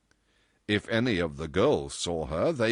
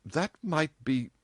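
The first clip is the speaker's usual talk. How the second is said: slow, higher, unfinished reply; hesitant; 8 semitones higher, slower than usual. A narrator's reading. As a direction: waver over the idea. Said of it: "she hesitated".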